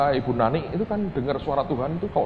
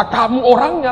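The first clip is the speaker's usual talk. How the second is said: high, loud quoted accusation; imitating the man's outburst; retold shout; 12 semitones higher, 10 dB louder than usual. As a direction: throw the accusation out at full voice. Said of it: "sehingga dia berkata".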